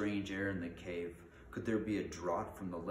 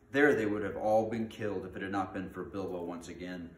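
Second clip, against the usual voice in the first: dramatic fantasy storytelling; animated read-aloud of an adventure tale; story narration; 6 dB louder than usual. A narrator's reading. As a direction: read it out with punch on the key words.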